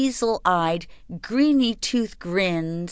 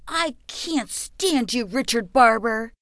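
angry scream; 5 semitones higher than usual. A narrator's reading. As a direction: yell it at him in a rage. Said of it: "I screamed".

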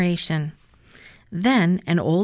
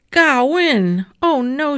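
higher and louder in sudden dismay; alarmed cry; 7 semitones higher, 6 dB louder than usual. A narrator's reading. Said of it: "she cried".

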